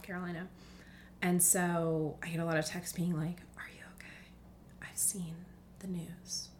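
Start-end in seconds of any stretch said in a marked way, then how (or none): 3.6-6.5 soft nervous whisper; worried whisper. Retold or imitated. Family texting her about the news, nervous whisper. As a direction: whisper it like you fear the answer. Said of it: "we're always whispering".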